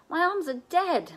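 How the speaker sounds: high, slow, worn-out complaint; drained, whining through exhaustion; complaining. A narrator's reading. Seth to Kendra, exhausted from sliding. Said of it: "Seth complained".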